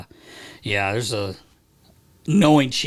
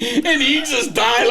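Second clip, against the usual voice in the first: higher and slow, laughing out the words; can barely get it out for laughing; 10 semitones higher, 3 dB louder, much slower than usual. Real, not acted. Hockey guy retelling a chirp to friends, laughing hard. Laughing at his own story.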